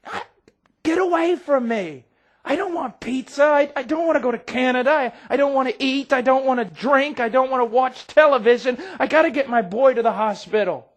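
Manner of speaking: high, loud frantic refusals; desperate shout. Retold or imitated. Father refuses pizza, shouting desperately for his son. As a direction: shout them off, frantic for your boy.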